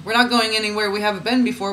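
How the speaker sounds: usual speaking voice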